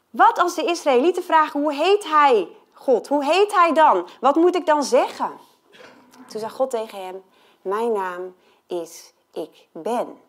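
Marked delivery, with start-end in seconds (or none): 0.1-5.3 raised, louder pleading question; a hint of an urgent Moses; Moses asking. Moses quoted asking God. 7.6-10.2 slow, low, softer solemn voice; hushed, weighty God voice. Retold or imitated. God's answer voiced.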